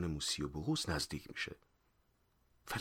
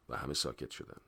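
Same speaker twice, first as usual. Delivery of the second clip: ordinary delivery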